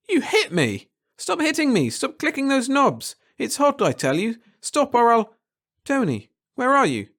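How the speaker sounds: high, pleading shout; frantic plea in a fever; panicked cry. A narrator's reading. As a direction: beg him to stop, voice cracking up high. Hero cries out in fever.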